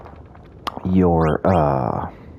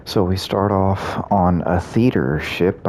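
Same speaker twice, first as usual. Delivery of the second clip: normal talking voice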